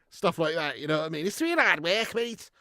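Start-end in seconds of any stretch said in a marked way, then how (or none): 1.3-2.5 put-on high-pitched, thick Scouse accent; proper Scouse. Imitating a Scouser asked to repeat himself, thick Scouse accent. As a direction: garble it like a hard-to-follow Scouser. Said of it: "I've met some proper Scouse".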